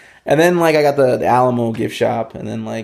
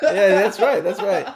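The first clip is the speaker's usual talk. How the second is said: higher, chuckling agreement; laughing along; 8 semitones higher, slower than usual. Real, not acted. Poet to host, agreeing while laughing at the joke.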